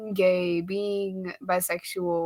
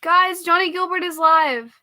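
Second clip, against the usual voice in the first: excited gushing; 7 semitones higher, 4 dB louder than usual. Real, not acted.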